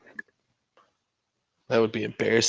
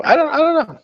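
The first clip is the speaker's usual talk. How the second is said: much higher squeaky shrug; falsetto shrug; 3 dB louder than usual. Pretend. Streamer dodging his own question: squeaky falsetto shrug. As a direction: toss it off in a squeak.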